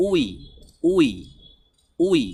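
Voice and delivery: "উই" said as one "wee" sound, repeated with pauses; pronunciation demo. Shown as a demonstration. Teacher showing learners how the two letters sound together, demonstrating "উই". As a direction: model "উই" as W and E joined, each one separate. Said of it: "উচ্চারণটি হবে".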